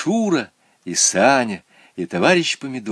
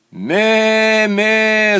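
high, loud "Ме, ме" bleats; loud goat bleat; 11 semitones higher, 5 dB louder than usual. A narrator's reading. Goat repeats its syllable for the class, bleating. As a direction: blare it like a goat. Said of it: "закричал Полкан".

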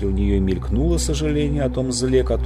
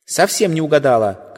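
higher, louder outburst; shouting girl; 7 semitones higher, 5 dB louder than usual. Acted. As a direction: shout it defiantly. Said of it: "крикнула Полли".

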